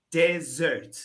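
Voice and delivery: ordinary talk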